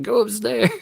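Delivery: high-pitched retold command; retold order. Parent retelling sending kid upstairs, high and comic. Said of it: "was like".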